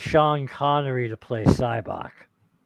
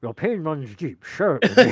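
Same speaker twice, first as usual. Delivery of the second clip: put-on Scottish accent; 3 semitones higher than usual. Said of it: "in his glorious Scottish accent".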